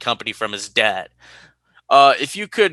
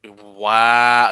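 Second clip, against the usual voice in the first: ordinary talk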